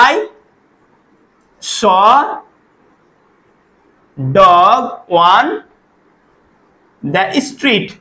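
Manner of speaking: word by word with long pauses; slow gap-fill dictation. Shown as a demonstration. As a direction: dictate the exam sentence word by word. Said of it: "এই বাক্যটা যদি এরকম থাকে".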